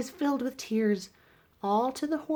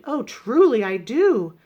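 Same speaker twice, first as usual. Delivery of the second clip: hooting uncle; 8 dB louder than usual. A narrator's reading. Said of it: "Fred hooted".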